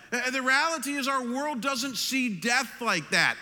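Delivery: high, insistent rhetorical challenge; preaching at full force. Acted. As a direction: drive each point home at a sustained high pitch.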